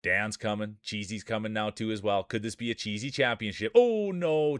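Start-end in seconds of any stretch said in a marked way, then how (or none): 3.7-4.6 higher surprised cry. Real, not acted. Commentator seeing Cheesy's run end, surprised cry. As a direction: caught off guard as a racer falls. Racer gets knocked out.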